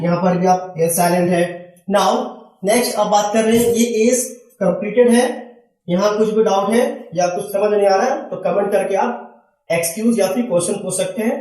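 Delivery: ordinary talk, nothing marked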